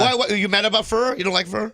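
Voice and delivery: high, loud disbelief; can't believe it's about fur; incredulous. Real, not acted. Host asked about fur, guest reacts in high loud disbelief.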